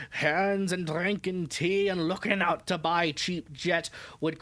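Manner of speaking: put-on old sailor's broad dialect accent; thick country accent, read as written in dialect; thick rustic accent. Old sailor dismissing local legends to a young lady, thick rustic accent. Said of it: "Thick accent on that guy".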